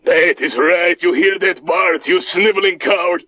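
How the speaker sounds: mock-scornful Eastern European accent; Boris voice. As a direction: sneer at your cousin in the accent. Boris mocks Bart.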